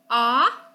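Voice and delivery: "ah" said as the short O of "hot"; teacher modelling the short vowel "ah"; short O sound. Shown as a demonstration. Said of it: "short O".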